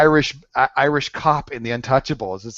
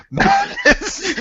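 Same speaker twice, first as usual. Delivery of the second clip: much higher, squealed "Madness?" as a joke; mock Spartan yell. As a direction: screech the line in parody. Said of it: "Spartan madness quote".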